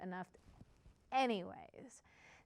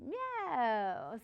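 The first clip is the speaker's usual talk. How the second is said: long rising cat meow; happy kitty meow; 3 dB quieter than usual. Shown as a demonstration. Teacher turning students into kitties, playful meow. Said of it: "become happy kitties".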